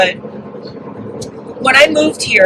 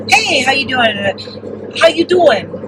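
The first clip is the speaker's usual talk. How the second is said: drawn-out New York-New Jersey greeting; New York-New Jersey accent; 3 semitones higher, slower than usual. Retold or imitated. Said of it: "so I started picking it up".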